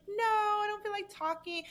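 high-pitched mock protest; retold protest. Retelling own protest to strategist.

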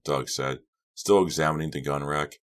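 plain reading voice

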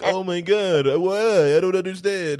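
hammed-up innocent sibling; mock innocent whine. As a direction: play dumb loudly after tattling. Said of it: "and then look back like".

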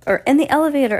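high, loud shout across the room. A narrator's reading. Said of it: "a woman shouted".

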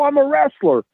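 high-pitched indignant retort; insulted. Retold or imitated. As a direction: retell it as an affronted, high protest. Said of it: "Well, I was insulted".

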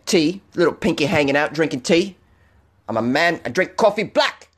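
put-on terrible British accent. Podcaster mocking tea drinkers to a UK listener, terrible British accent. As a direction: do a badly faked Brit, halting and cocky. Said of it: "That was a terrible accent".

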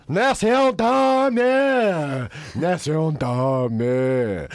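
slow, drawn-out operatic wail; stretched grand opera parody; mock opera singer. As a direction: ham up a pompous opera line, very slow. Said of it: "someone coming out going".

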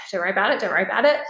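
rushed mumble. Retold or imitated. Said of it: "look at my dinner plate and just go".

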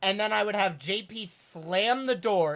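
normal talking voice

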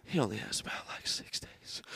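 hushed grim news; mock doctor whispering bad news.

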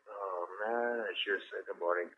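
low, hushed retold reaction; quiet, deflated mock regret; rueful aside. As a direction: drop it right down, as if to yourself. Retells his reaction to the slap.

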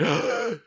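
high mock gasp of alarm; startled gasp. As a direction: gasp as if caught out. Acts out checking the recording.

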